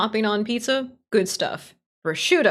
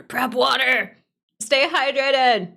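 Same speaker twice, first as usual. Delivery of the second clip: calling out; 5 semitones higher, 4 dB louder, slower than usual. Real, not acted. Calling out to chat.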